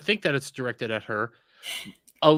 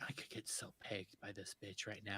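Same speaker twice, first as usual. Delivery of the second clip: whispered inner thought; 6 semitones lower, 24 dB quieter than usual. Pretend. Voicing a man's thoughts.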